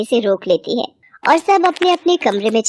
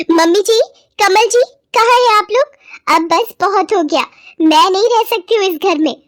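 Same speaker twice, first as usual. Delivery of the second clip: much higher, agitated calling out; shouted summons; 9 semitones higher, 3 dB louder than usual. Acted. Anjali summoning the family in uproar, high shouting. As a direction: call the whole house, then complain high. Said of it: "चिल्लाते हुए घर में सबको".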